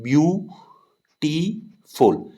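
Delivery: Beautiful broken into three drawn-out beats, view, टी, फुल, with pauses between; syllable by syllable. Shown as a demonstration. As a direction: sound out beautiful slowly as view... टी... फुल for a class. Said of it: "beautiful में तीन syllable हैं".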